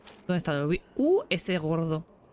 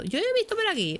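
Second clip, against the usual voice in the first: whiny high complaint; 12 semitones higher than usual. Real, not acted. Frustrated search for the item.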